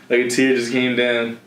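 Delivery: usual speaking voice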